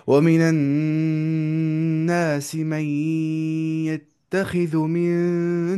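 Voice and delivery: slow, melodic Quran chant; Quranic recitation. Shown as a demonstration. Teacher chanting a verse for tajweed students, slow and melodic. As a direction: draw the verse out in a measured chant.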